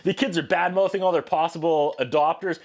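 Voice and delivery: usual speaking voice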